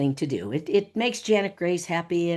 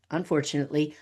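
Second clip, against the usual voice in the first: ordinary delivery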